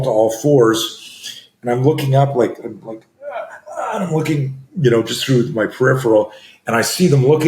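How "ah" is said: high, soft faked cry of pain; a pretend wounded moan; hammed-up pained "Ah"; faked dying groan. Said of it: "pretending I'm dying".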